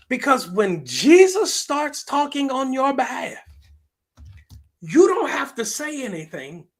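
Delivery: high, loud, drawn-out proclamation; soaring pulpit climax; full-force preaching. Real, not acted. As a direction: drive it home to the congregation at full voice.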